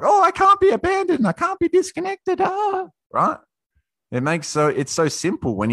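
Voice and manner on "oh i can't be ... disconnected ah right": much higher, squeaky whine mocking the clingy crowd; mocking whiny voice. Speaker mocks everyone afraid of abandonment, high squeaky whine. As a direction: sneer at people who cling, in a squeaky falsetto. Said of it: "everyone's like".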